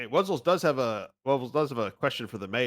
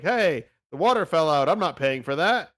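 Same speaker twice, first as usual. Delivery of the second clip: loud, raised mock protest; complaining customer; 4 semitones higher, 7 dB louder than usual. Player acting out someone refusing to pay, loud mock complaint. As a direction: protest loudly as a cheated customer. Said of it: "someone's like".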